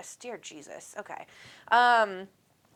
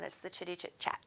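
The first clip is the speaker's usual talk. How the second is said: whispered; 14 dB quieter, faster than usual. Shown as a demonstration. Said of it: "That's not the fun version".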